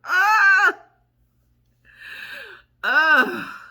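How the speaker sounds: loud high laughing shriek. Real, not acted. Realizing she's almost forty, a loud shrieking laugh.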